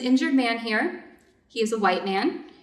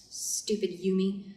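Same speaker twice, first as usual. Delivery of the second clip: annoyed mutter; 5 semitones lower, 6 dB quieter than usual. Acted. Muttered aside about servant.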